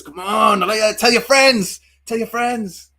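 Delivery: loud, high hyped-up call; excited high-pitched cheerleading; rallying the viewers. Real, not acted. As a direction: shout it out to rally the audience. Calling out to new viewers.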